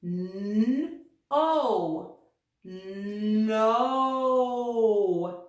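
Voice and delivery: N, O, then no said as one blended syllable; blending the syllable. Shown as a demonstration. Teacher blending sounds for young readers. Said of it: "put that together to read the syllable".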